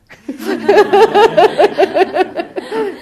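loud, high laugh; burst of laughter. Real, not acted. Laughing loudly at her own story's punchline.

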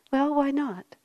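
ordinary talk

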